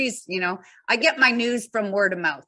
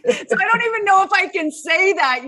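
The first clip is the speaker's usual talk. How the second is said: high, loud giggly talk; laughing; 11 semitones higher, 6 dB louder than usual. Real, not acted. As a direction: laugh through the words. Laughing, unsure of term.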